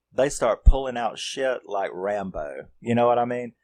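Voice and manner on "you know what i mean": ordinary talk, nothing marked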